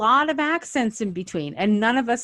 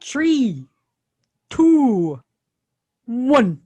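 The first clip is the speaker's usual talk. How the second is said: put-on countdown in a light Canadian accent, higher and louder; 5 semitones higher, 5 dB louder, much slower than usual. Host counting down the rewatch, playful Canadian accent. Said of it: "that was my Canadian accent".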